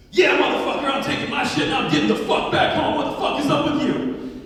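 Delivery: high, loud profane rant; loud phone talker. Retold or imitated. Man on a plane starts talking on his phone, loud and brash. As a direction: belt out the brash cell-phone call. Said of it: "he kinda sounds like".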